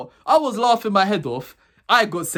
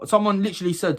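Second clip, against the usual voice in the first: ordinary delivery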